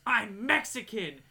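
loud, drawn-out retold scream; mock scream. Woman screams at the guard mid-description, mock scream. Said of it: "she just looks at me and screams".

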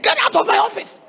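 high, loud, stammering tirade; furious retold outburst. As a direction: re-enact throwing him out, shouting in outrage. Retells throwing the husband out.

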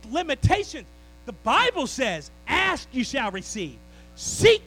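loud, driving pulpit cadence; preaching at full force. Acted. Pastor urging the church to stay faithful, loud preaching. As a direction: preach it out hard to the whole congregation.

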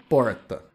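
porta with a curled-back retroflex caipira R. Shown as a demonstration. Linguist showing listeners his own caipira R, porta retroflex. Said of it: "Esse meu R é o que os linguistas chamam de R retroflexo".

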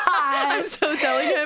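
slow, laughing taunt; laughing tease. Real, not acted. Sister teasing sister about her pun, laughing hard. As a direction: gleefully threaten to tell, cracking up.

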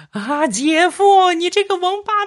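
very high, drawn-out screaming curse; hammed-up labour-room scream; full-throated yell. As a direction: howl it out at the top of your voice like a woman in labour. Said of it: "扯着嗓子就吼道".

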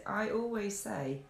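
usual speaking voice

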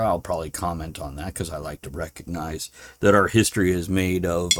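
ordinary delivery

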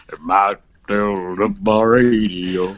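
low, slow, goofy drawl; mock dazed customer. Caller muttering to the rep, low slow goof. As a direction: drag the line out like a confused caller. Customer line again, laughing.

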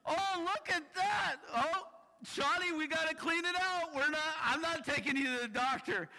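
fussing mom. Retold or imitated. Nurse mom inspecting son's scraped knee, high fussy voice. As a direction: play the no-nonsense nurse mom. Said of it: "So my mom goes".

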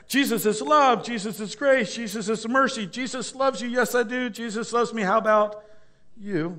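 high, sing-song t-shirt slogan voice; mock nineties church chant; cheesy slogan. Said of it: "It's like".

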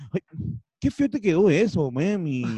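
lightly mocked sweetheart voice; imitando a Tito. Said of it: "me imagino a Tito hablando así". (imitación melosa y burlona)

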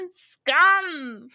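high, loud theatrical exclamation; hammed-up insult. Villain to her attacker, hammed-up loud high yell. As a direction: overplay it like a stage villain.

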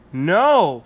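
loud high protest; cry. Acted. Rory refuses Mum, loud cry. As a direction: shout it to save the dragon. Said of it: "cried Rory".

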